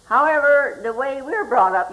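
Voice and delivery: ordinary talk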